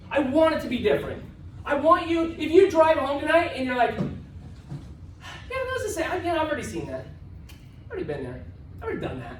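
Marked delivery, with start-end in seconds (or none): 3.9-9.4 unimpressed mimicry, falling in pitch; mock indifference. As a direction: imitate a bored attendee, quietly trailing down.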